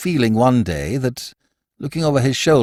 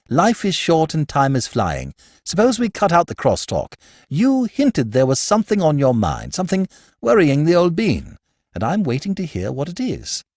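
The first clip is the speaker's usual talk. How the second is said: put-on a touch higher, breezy young toff teasing; lightly voiced Archie; 6 semitones higher than usual. A narrator's reading. Archie teasing his gloomy brother-in-law at lunch, airy and light. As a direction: rib your friend with airy cheer. Archie pressing Bill to talk.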